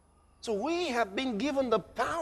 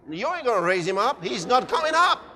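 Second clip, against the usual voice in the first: normal talking voice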